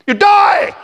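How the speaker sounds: sudden high shout. Acted. Author shouting the punchline at the pupils. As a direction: blast the punchline out to the kids.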